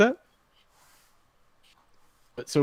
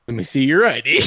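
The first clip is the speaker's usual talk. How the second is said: imitated smart-aleck comeback with a laugh in it; mock-challenge, punched harder; mocking retort; 4 dB louder than usual. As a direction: throw the demand back, cocky. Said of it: "I went".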